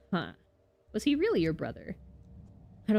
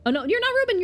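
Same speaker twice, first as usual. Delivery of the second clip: ordinary delivery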